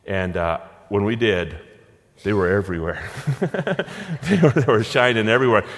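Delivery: ordinary delivery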